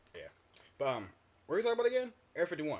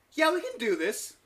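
much higher, louder, drawn-out cheer; excited cheer; 14 semitones higher, 12 dB louder than usual. Retold or imitated. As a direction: hype yourself up. Imagined optimistic self.

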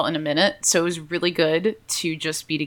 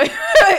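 laugh; 5 dB louder than usual. Real, not acted. Laughs mid-answer.

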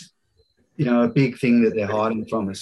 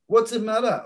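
quoted catchphrase; 8 semitones higher than usual. Retold or imitated. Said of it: "I love that one".

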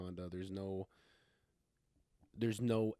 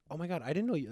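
imitating a surprised friend, higher and louder; amazed friend; 7 semitones higher, 3 dB louder, faster than usual. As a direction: gush in mock astonishment. Friend breaking news to Sandra, imagined.

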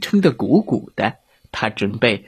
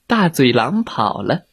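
usual speaking voice

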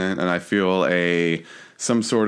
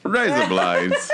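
slow, laughing Aussie rise up lights; slow mock Crocodile Dundee accent; Australian drawl, laughing; slower than usual. Shown as a demonstration. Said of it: "Crocodile Dundee saying that".

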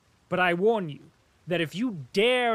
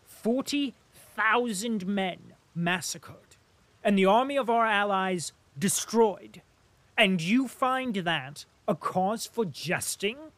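louder, impassioned tirade; excited outburst; 4 semitones higher, 5 dB louder than usual. Acted. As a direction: pour out his indignation at the jester. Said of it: "standing still in his excitement".

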